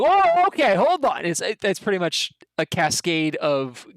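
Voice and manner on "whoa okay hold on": higher, louder startled protest; defensive goblin. Acted. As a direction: back off fast, defending yourself. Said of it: "And Bill's like".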